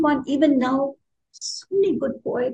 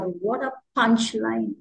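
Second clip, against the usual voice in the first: ordinary delivery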